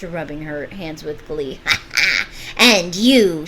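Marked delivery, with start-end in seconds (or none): 1.6-2.5 gleeful evil cackle; cackle, rubbing hands with glee; witch cackle. Acted. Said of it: "She laughed". 2.6-3.5 loud, sharp witch bark. Acted. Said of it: "looked sharply at Gretel".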